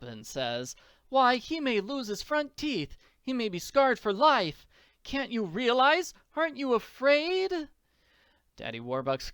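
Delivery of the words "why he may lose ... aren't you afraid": high, distraught pleading; upset wife. Acted. Mother fearing her son is scarred for life, upset. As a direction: plead in alarm, pitched high and loud. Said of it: "Missus Warbucks is upset. She's throwing her hands up".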